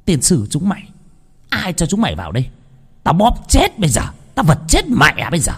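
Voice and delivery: high, furious ghost screech; a spirit in the aunt screams at the intruding family; possessed screaming. Acted. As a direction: shriek the threats like a possessed woman. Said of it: "mắt trợn lên mà hét lên".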